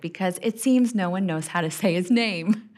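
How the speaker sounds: ordinary talk, nothing marked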